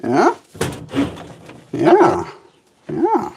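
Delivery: high sing-song pet talk. Acted. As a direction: coax a pet gently. Coaxing pet bird outside.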